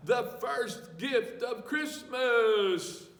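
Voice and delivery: high, loud and slow, called out; grand proclamation. A narrator's reading. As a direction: proclaim it to the cheering elves. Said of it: "called out".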